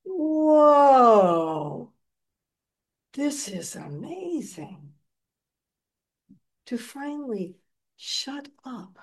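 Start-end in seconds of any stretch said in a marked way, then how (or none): 0.0-4.9 amazed whoa. Retold or imitated. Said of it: "it's like".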